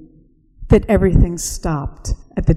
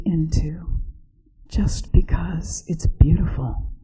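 soft hushed whisper; 5 dB quieter than usual. Acted. Narrator turning the question to listeners, whispering. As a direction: ask it gently, barely voiced.

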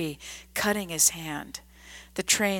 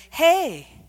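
a much higher, louder lilting call; joyous shout; 10 semitones higher, 8 dB louder than usual. A narrator's reading. Old gentleman calls out to the drunk, joyous shout. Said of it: "someone shouted".

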